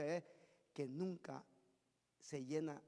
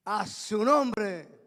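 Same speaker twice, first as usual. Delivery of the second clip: loud, long-drawn preacher's call; congregational call; 11 dB louder, much slower than usual. Real, not acted. Preacher rousing the congregation to praise, loud drawn-out call. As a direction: call out slowly for the response.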